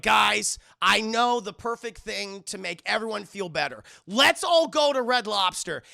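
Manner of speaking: loud call, rising to a big finish; rallying announcement. Retold or imitated. Retold rallying call to group.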